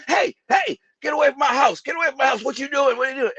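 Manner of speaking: high-pitched shouting neighbor lady; shrill-high shout to get the stranger away; yelling woman. Retold or imitated. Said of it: "lady yelling and screaming at me".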